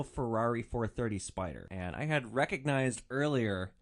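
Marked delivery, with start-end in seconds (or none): none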